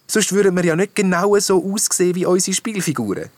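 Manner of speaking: high-pitched, assured reply; confident princess. A narrator's reading. Princess answering the mermaid's question, confidently.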